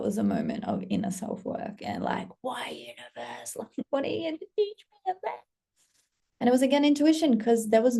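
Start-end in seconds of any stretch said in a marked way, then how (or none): 2.4-5.4 soft whisper rising high; whispered mock plea. Creator asking the universe why, whispered plea. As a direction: plead softly upward, half laughing.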